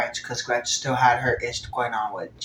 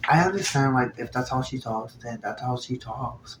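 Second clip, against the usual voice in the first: ordinary talk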